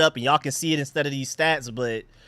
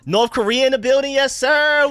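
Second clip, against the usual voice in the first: much higher and louder, hyped welcome; excited shout-out; 10 semitones higher, 5 dB louder than usual. Real, not acted. Streamer greeting a surprise North Korea viewer, high hyped shout-out.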